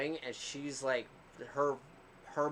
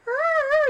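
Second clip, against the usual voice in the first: loud, high mock cry of pleasure; imitated loud moan; 14 dB louder than usual. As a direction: belt out her moan, way higher and louder. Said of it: "and I hear".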